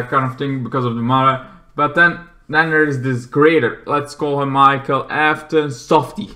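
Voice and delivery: ordinary talk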